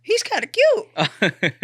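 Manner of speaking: high, slow, loud coo; imitated approving mom. Mom seeing the boyfriend's picture, approving coo. Mom's approving reaction, retold.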